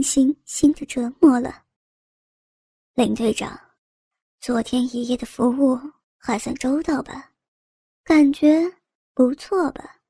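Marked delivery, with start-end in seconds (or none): 2.9-10.0 mocking. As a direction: taunt her with a sneer. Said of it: "用嘲笑语气问道".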